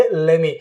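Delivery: "Let me" as lemme; one-word "Let me", lem-mee; silent T, joined. Shown as a demonstration. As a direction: blend "Let me" with no T. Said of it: "दोनों को एक साथ जोड़ के".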